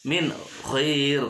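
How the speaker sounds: "min khair" with a short clear n; "min khair", no hum; clear idhar reading. Shown as a demonstration. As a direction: cut "min" before "khair". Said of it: "tidak boleh dibaca".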